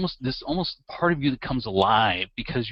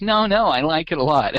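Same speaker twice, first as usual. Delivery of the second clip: loud, higher-pitched mock protest; mousy, insecure guy; 5 semitones higher, 8 dB louder than usual. Insecure guy hastily reassuring the woman, mousy mock voice. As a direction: imitate an insecure man backpedalling, pitched up and louder. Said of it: "It just sounded so horrible".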